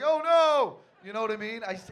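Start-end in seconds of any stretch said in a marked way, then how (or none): 0.0-0.8 loud high wail of dismay; panicked cry. Retold or imitated. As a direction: cry out in horror. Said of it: "I was like".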